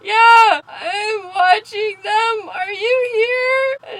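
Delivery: lightly hammed-up dread, stretching the words; mock nervous reply. Storyteller replays texting the creepy stranger, mock nervous.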